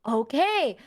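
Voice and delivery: slow, drawn-out oh okay; slow mock agreement. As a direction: stretch it like you're taking it in. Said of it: "And I'm like".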